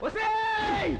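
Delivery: furious summoning yell; angry shout. Acted. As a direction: bark her name across the room. Man shouts at woman in quarrel.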